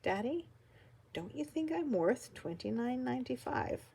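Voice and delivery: put-on soft, high little-girl voice; small, high, hushed question; quiet child. A narrator's reading. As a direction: ask softly and timidly, like a small child. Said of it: "Taya quietly said".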